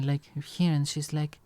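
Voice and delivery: ordinary talk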